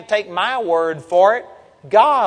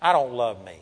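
retold despair; 5 semitones lower, 5 dB quieter than usual. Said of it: "I got so disappointed with myself".

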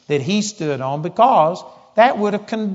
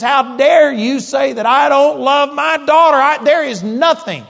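much higher, louder furious yell; enraged shout; 8 semitones higher, 6 dB louder than usual. Retold or imitated. Outraged father shouting at the preacher, enraged. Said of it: "he just was so violent".

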